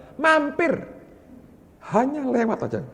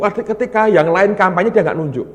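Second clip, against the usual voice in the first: usual speaking voice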